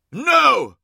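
loud, high cry of refusal; anguished cry. A narrator's reading. Magnus refuses his legion's doom, crying out loudly. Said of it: "cried Magnus".

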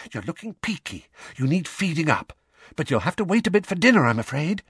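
high, fussing motherly voice. A narrator's reading. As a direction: voice a fretting mother. Mrs Weasley fussing over Harry.

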